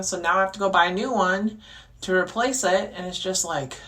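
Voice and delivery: ordinary talk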